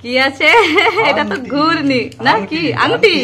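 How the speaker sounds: normal talking voice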